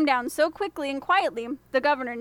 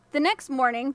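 plain reading voice

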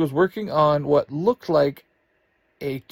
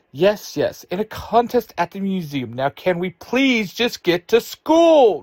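shouting; 8 semitones higher, 6 dB louder than usual. A narrator's reading. Fed up with the questions, shouting to get to school. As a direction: lose patience and yell it out. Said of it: "Ruthie shouts".

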